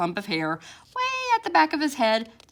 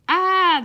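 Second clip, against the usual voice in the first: satisfied sigh; 10 semitones higher, 9 dB louder than usual. Acted. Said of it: "sighing a confident job well done sigh".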